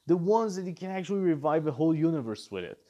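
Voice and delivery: normal talking voice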